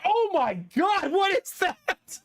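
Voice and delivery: high, slow, horrified cry; disgusted shock. Retold or imitated. Smelling the rotten yogurt in the bag, horrified and slow. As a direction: recoil from the stench, drawn out.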